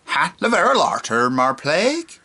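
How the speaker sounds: put-on an old Cornish pirate accent. Shown as a demonstration. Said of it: "And say again".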